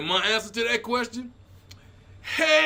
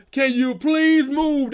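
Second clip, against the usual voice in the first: louder, slow mock PA call; yelled over a PA; 4 semitones higher, 6 dB louder, slower than usual. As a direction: call it out slowly over a loudspeaker. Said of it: "They yelled through the P A system".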